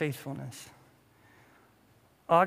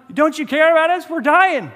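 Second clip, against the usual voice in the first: high, loud mock-desperate cry; panicked pleading; 9 semitones higher, 10 dB louder than usual. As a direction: plead frantically, hammed up, to wake a sleeper. Disciples waking Jesus in panic.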